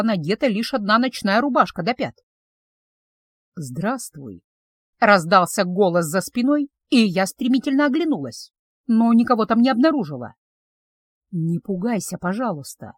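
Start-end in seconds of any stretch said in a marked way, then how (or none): 3.6-4.4 lower, softer male greeting; mysterious male voice. Acted. Unseen man greets the startled witch, soft and low. Male voice behind her in dream. 11.3-12.9 calm her down gently; reassuring male voice. Acted. Unseen man soothes the frightened witch, soft and low.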